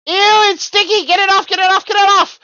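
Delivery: high frantic squealing, fast; phobic panic. Acted. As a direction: shriek in disgusted panic. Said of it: "flailing about wildly".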